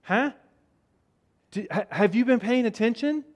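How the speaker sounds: mild incredulity. Acted. Jesus to fretting disciples, mildly incredulous. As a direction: check whether they followed any of it.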